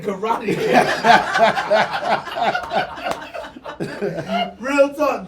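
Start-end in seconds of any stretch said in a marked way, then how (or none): 0.7-4.6 silent wheezing laugh, loud; breathless wheezing laugh. Real, not acted. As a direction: wheeze with laughter at your own story. Laughs at own karate story.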